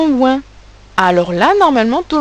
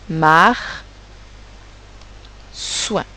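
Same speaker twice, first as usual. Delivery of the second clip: "Mar", long pause, then "soin"; syllable by syllable; 3 semitones lower than usual. Shown as a demonstration. Teacher helping pupils write, "Mar, soin" syllable by syllable. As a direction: dictate "Mar, soin" in two slow halves. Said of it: "j'aide pour la première".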